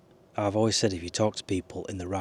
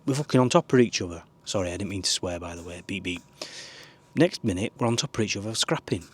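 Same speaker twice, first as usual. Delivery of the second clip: ordinary talk, nothing marked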